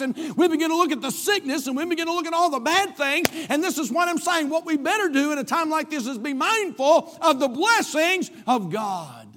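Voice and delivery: high, driving, rhythmic sermon delivery; impassioned preaching. Real, not acted. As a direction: exhort the church urgently, settling on the last words. Preaches at full force.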